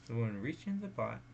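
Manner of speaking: ordinary delivery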